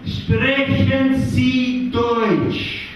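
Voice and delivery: mock German visitor, drawn-out and a bit sing-song; German tourist. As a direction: ask it slowly like a polite German visitor. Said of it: "coming up to you and saying".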